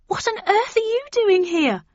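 very high, loud amazed question; astonished. A narrator's reading. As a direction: ask it in utter disbelief. Astonished to see Gran.

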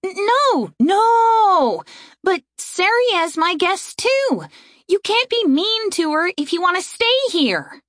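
high, insistent objection; alarmed protest. Acted. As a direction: stand up for your guest. Erin protests to the fairies.

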